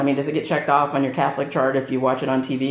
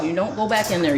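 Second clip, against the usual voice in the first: ordinary delivery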